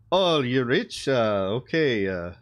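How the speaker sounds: lightly put-on Russian accent, slowing on okay; mock Russian accent, half-hearted; vague Russian accent. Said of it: "I don't know what accent that is".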